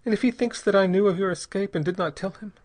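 put-on high, pleading young woman's voice; frightened young mother. A narrator's reading. Young mother pleads with Bell, high and frightened. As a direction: voice her as a terrified wife begging.

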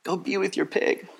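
a brother quietly releasing his sister; soft urging. Retold or imitated. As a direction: murmur it gently to his sister. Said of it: "And the brother says".

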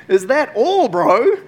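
high, loud, teasing God voice; mighty creator scoffing; cocky God. Retold or imitated. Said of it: "what I imagine God's response being".

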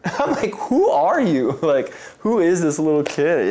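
amused delighted wonder; laughing amazement. Real, not acted. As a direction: marvel at him through laughter.

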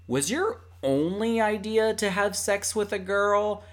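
voicing the assistant. Assistant voiced in retelling.

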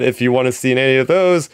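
ordinary talk

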